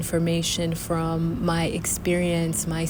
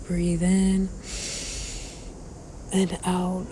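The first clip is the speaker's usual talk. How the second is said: guided meditation. Acted. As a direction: guide the breath calmly. Leads a breathing meditation.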